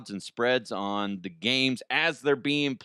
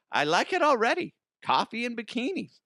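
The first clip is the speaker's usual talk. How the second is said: excited; 11 semitones higher than usual. Real, not acted. Excited reaction.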